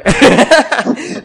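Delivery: loud laugh. Real, not acted. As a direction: burst of laughter. Reacts to joke.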